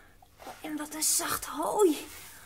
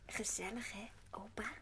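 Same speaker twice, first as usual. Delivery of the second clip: soft whispered, sleepy and content; cosy whisper; 9 semitones lower, 17 dB quieter than usual. Acted. Heidi cosying up to grandpa at the table, whispered. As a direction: whisper it snugly.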